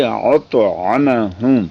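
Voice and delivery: اطعمہم slowly with a thick, heavy ta; showing the correct reading slowly. Shown as a demonstration. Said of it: "تو اس کو پڑھیں گے".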